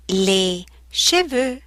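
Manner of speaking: "les cheveux" slowed, "che" stressed; slow textbook pronunciation. Shown as a demonstration. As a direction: repeat "les cheveux" slowly for learners. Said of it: "Che, che".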